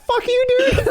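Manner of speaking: high squeal through laughter; laughing shriek. Retold or imitated. Cursing at his teammate while laughing, high squeal.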